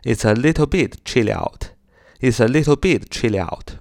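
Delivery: "It's a little bit chilly out" run together as one phrase; linked speech. Shown as a demonstration. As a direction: link "It's a" and "chilly out" without gaps. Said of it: "如果连读起来就是". (把"It's a little bit chilly out"连读)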